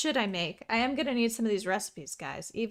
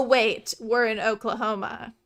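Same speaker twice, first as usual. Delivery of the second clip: usual speaking voice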